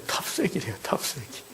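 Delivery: hushed, breathy 탑세기 with no voice; whispered dialect word. Shown as a demonstration. Pastor whispers the Chungcheong word for dust, whispering. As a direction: whisper 탑세기 softly like a secret. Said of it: "먼지가 충청도 말로 뭐라고요?".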